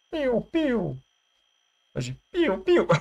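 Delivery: звук выстрела. Acted. Озвучивает выстрелы в игре.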